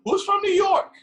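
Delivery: high, loud imitated sergeant yell; hammed-up sergeant call; mock drill shout. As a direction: shout the question at the recruits. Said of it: "It's like".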